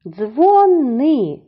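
"Дзвони" slowly, with a long high -ни; stress on second syllable. Shown as a demonstration. Teacher dividing the word, high stressed second syllable. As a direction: stretch NY high in "Дзвони": dzvo-NYYY. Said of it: "другому складі".

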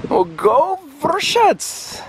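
high, slow drawn-out cheer. Real, not acted. Vlogger cheering for the town's football field, drawn-out cheer. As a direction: cheer the town on like a fan.

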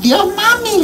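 high, sing-song goodbye back; hammed-up sweet farewell to the old woman; mock sweet reply. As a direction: play the doting daughter. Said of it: "le dije yo".

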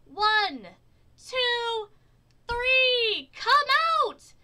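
very high, loud countdown; full-out crowd chant; crowd yell. A narrator's reading. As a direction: yell each count separately, building to the last words. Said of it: "The crowd yelled".

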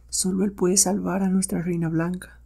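high, hushed anxious voice; fearful old Guk. Acted. Old Guk flees and decides to call for help, fearful. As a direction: fret to yourself, soft and high.

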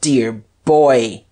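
forceful cry of triumph; triumphant. A narrator's reading. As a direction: pounce on the words in triumph. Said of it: "cried Guerchard triumphantly".

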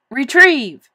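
ringing command to retrieve; shouted command. A narrator's reading. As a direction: call it out firmly to a pet. Boy commands tadpole to fetch.

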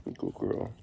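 soft unvoiced whisper. Real, not acted. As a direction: whisper not to disturb her. Quietly watching cuddling pet.

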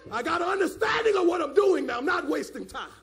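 loud, high bursts of fervent preaching; forceful pulpit shout; full-force preaching. Real, not acted. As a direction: preach it hard, driving the key words up.

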